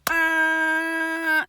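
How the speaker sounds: long, high truck-horn blare on one note; sustained warning honk; imitated truck horn. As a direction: hold a steady "Ah" like a blasting horn. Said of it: "it wasn't like a honk".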